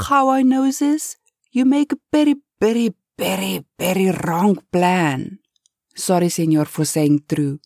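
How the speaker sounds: put-on excited broken Spanish-accented pleading; Spanish-accented butler. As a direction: warn and beg the boss in a Spanish accent. Eustacio's accented voice.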